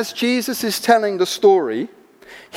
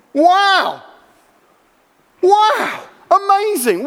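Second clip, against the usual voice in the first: awed wow; 7 semitones higher, much slower than usual. Real, not acted. Preacher's awe at the story.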